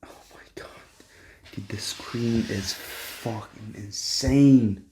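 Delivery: slow breathless whisper; hushed slow amazement; awed whisper. Real, not acted. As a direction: whisper it in awe. Marvelling at the screen.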